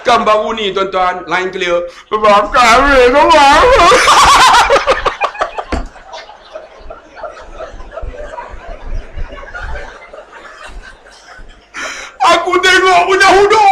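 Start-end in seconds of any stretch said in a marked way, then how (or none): 2.1-3.3 loud crying-out call; hammed-up tearful father-in-law crying out; imitating a yell. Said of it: "mamak teriak". 12.4-13.7 high loud exclaim; laughing shout about the crying; excited shout. Real, not acted. Excited retelling climax.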